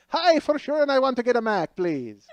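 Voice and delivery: put-on a half-committed Irish accent that trails off; bad Irish accent. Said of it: "that's an Irish guy".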